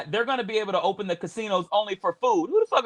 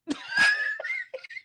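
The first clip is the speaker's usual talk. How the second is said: cracking up at his own line; high shrieking laugh; 3 dB quieter than usual. Real, not acted. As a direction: burst into a shrill cackle.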